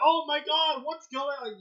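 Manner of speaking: high mock panic, fading off; lightly hammed-up alarm. Friend mocking characters panicking, high mock panic.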